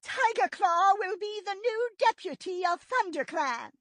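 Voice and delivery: high, clear leader proclamation; loud and clear. A narrator's reading. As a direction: ring out the name for the clan. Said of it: "meowed the name loud and clear".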